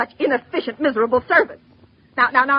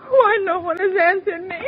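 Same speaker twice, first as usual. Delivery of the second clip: ordinary delivery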